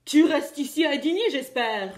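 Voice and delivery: loud roaring big bad wolf. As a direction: roar it, pouncing out. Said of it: "rugit Grand Loup".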